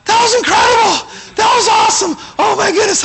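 wife shouting with joy after the base jump; thrilled shout. Retold or imitated. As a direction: burst out with joy after landing.

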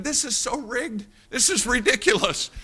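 high-pitched incredulous protest; a touch of outraged disbelief, voiced high; mock indignation. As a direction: protest it in a squeaky, put-out pitch. His indignant thought about rigged contest.

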